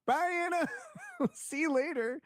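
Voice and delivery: mocking goodbye. He mocks the storming-off dancer with a high laughing goodbye. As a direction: wave her off with a high, cheeky mock goodbye.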